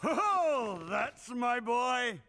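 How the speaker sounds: loud, slow proud cheer; proud shout. Acted. Father cheering his famous son, proud loud shout. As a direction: bellow it out with pride.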